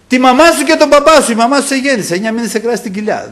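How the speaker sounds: high, loud, insistent reproach; Satan's guilt-tripping. Retold or imitated. Preacher voices Satan invoking his mother, loudly reproachful. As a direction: heap on guilt as the tempter. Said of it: "άκουγα τη φωνή του".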